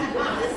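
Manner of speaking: short, high giggle; a quick high laugh; high burst of laughter. Real, not acted. Laughing at the forgotten Coke can, high giggle. Laughs at her own forgetfulness.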